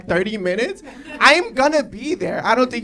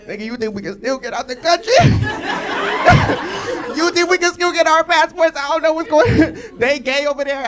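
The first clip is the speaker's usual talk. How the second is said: panicked worry; 6 semitones higher, 6 dB louder than usual. Retold or imitated. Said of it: "I'm like".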